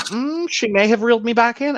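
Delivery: high-pitched swooning quote; high mock voice. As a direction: pitch it way up, dreamy. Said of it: "Mitchell's like".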